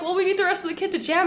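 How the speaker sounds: high, fast pleading; exasperated. Retold or imitated. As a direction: rush it out, exasperated.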